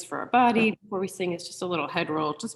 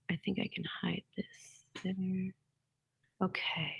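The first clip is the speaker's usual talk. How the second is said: whisper; 10 dB quieter than usual. Real, not acted. Aside while adjusting screen.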